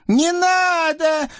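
very high, drawn-out frightened wail; terrified howl. Acted. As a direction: howl in panic. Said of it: "перепуганно взвыл Наум". (перепуганный вой)

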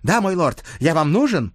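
high, keen servant voice; eager young page. Acted. Page answers his lord's summons, eagerly.

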